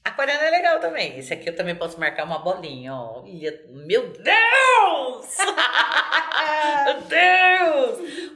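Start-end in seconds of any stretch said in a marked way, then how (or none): 3.2-8.3 high, loud, drawn-out cry; excited exclaim. Real, not acted. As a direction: gasp it out delighted. Exclaiming at aquarius card.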